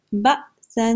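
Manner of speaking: بأسن with a sudden catch after "ba", then held "sann"; بأسن as "ba'-sann", abrupt then sustained; jolt on hamza. Shown as a demonstration. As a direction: jerk the first syllable of بأسن short, keep breath going. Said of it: "جھٹکا دینا ہے".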